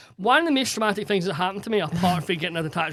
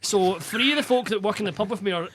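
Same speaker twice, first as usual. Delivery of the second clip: usual speaking voice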